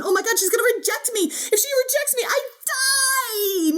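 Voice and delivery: high, fast, hammed-up panicky wail; mock panic. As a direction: play the inner voice spiraling into mock terror. Mock inner panic about rejection.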